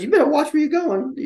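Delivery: high, loud scolding wife. Retold or imitated. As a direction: squeal it like the wife. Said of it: "She goes".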